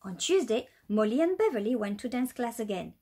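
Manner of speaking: plain reading voice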